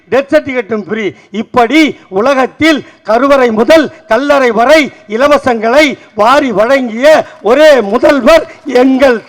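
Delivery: loud rhythmic declamation of questions and answers; ringing political platform speech; rousing stump oratory. Acted. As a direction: build it like a rally litany. Rally speech in rhetorical verse.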